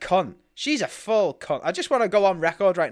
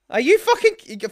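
much higher, louder disbelief; incredulous outburst; 13 semitones higher, 6 dB louder than usual. Real, not acted. Host venting to cohosts, high incredulous outburst. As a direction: nearly squeak with outrage.

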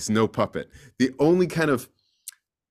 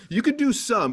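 usual speaking voice

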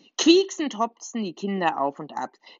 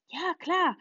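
high, eager chatter; excited babble; 9 semitones higher than usual. Acted. Poppy chattering excitedly to Diego, high and eager. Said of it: "plappert Poppy aufgeregt".

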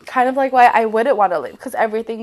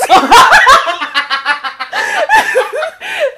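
loud laugh; 13 semitones higher, 7 dB louder than usual. Real, not acted. Laughing at racist remark.

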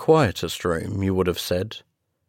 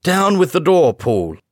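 much higher and louder command; urgent shout; 9 semitones higher, 6 dB louder than usual. A narrator's reading. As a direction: order the door broken down. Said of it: "cried Utterson".

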